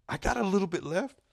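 high, loud put-on voice; imitated sheepish friend. Friend admitting what's left of his check, high mock-defensive voice. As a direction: pitch it way up as the friend making excuses. Friend's evasive answer, acted.

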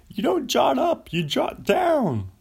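high, loud Seinfeld-style yelled punchline; mock Seinfeld. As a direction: yell it up high like a sitcom comic doing his bit.

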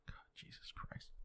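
hushed, disbelieving whisper. Real, not acted. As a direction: breathe it out under your breath in disbelief. Disgusted aside, drops voice.

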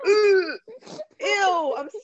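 high, cringing squeal easing off; mortified groan into a cringe; embarrassed. Real, not acted. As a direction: recoil in real embarrassment, high-pitched. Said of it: "I'm so embarrassed".

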